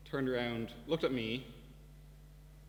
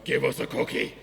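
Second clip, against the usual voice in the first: put-on much louder drunk man's demand; 10 dB louder than usual. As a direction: bark it at the cookie eater. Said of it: "And he goes".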